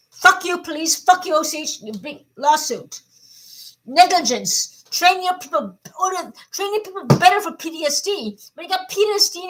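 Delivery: high, loud, raging yell; furious shout. Real, not acted. Cursing out the police and OCH, furious shout. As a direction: scream your fury at them.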